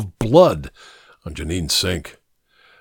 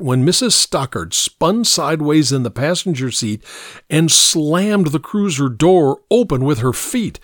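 ordinary delivery, nothing marked

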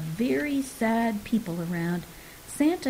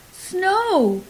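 much higher, louder joyful cry; excited yell; 10 semitones higher, 5 dB louder than usual. A narrator's reading. Sister discovering real snow in the box, joyful yell. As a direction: shout it with pure delight. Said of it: "yelled Abby".

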